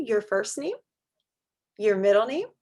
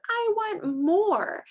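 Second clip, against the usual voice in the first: put-on whiny little kid; 11 semitones higher, much slower than usual. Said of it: "you said".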